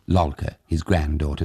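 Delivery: plain reading voice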